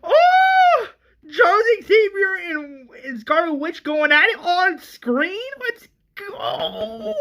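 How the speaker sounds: very high excited screaming; hammed-up shrieks of hype; ecstatic fan freak-out. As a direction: squeal like a fan losing it. Re-enacting fan reaction to Xavier's showdown.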